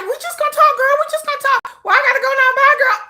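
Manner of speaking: sing-song reassurance while leaving; high mock innocence. Brushing off her friend on the way out, high breezy excuse. Girlfriend insisting, rushing off.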